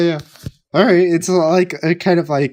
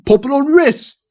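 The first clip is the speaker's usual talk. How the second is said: high, louder mock Cockney; thick Cockney accent; 11 semitones higher, 5 dB louder than usual. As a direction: exclaim it in an exaggerated Cockney voice. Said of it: "a really thick Cockney accent".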